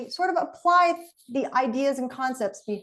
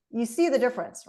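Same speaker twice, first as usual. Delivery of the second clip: ordinary delivery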